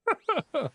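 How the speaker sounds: real high-pitched giggle; short, high burst of laughter; giddy laugh. Real, not acted. Streamer left speechless by luck, giddy laugh.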